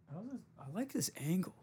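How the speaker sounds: sarcastically admiring his own camera angle; mock pleased. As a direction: pretend to love the camera angle. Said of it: "I don't at all, though".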